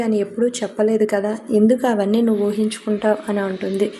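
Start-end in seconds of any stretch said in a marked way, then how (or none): none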